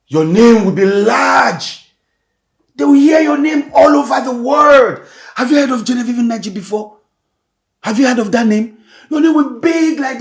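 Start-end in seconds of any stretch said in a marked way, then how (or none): 0.1-7.0 higher, louder grand proclamation; father loudly foretelling his daughter's fame; proclaiming shout. Acted. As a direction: announce her fame to the world. Father proclaiming her future fame.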